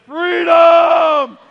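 long, high battle cry at full volume; triumphant shout. Acted. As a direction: bellow it out like a victorious warrior, drawing out the word. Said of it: "Can you imagine David shouting this out?".

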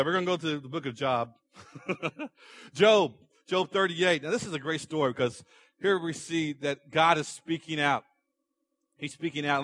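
usual speaking voice

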